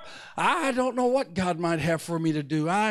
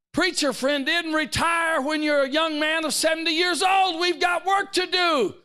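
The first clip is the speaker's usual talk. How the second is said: full-force sermon, urging the church; fiery preaching; 8 semitones higher than usual. Acted. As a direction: drive the point home to the pews.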